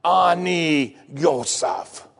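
very slow, drawn-out Hebrew words; Joseph revealing himself. Acted. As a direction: let each syllable land, slowly. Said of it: "First time they heard Hebrew words".

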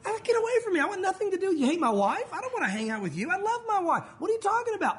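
high, indignant recoil at the insult; a husband defending his wife, shocked and indignant; offended outburst. Acted. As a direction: push the friend away, appalled. Pastor acts offended husband.

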